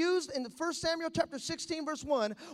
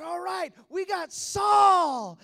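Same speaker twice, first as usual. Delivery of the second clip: loud, drawn-out shout on the name; celebrate, stretching the last word long; triumphant cheer; 4 semitones higher, 8 dB louder, much slower than usual. Acted. Israel cheering its new king, loud and triumphant.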